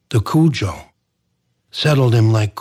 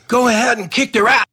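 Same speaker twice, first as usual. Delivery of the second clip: a fast, high, louder holler; hollered urging; 12 semitones higher, 4 dB louder, faster than usual. A narrator's reading. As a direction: egg him on, quick and loud. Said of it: "he hollered".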